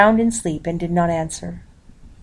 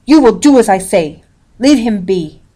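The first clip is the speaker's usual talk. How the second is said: loud, commanding shout; 3 semitones higher, 7 dB louder than usual. A narrator's reading. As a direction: bark the order like her father would. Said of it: "I shouted".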